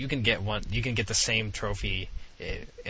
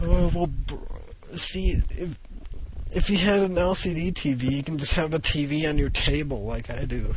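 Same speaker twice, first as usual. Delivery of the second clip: excited boast; 6 semitones higher, 3 dB louder than usual. Real, not acted. Excited boast about LCD TV.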